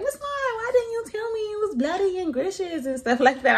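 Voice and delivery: put-on high, whiny little boy's voice; high, indignant child voice; complaining kid. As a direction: play the kid protesting to his teacher. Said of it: "he came to school today like".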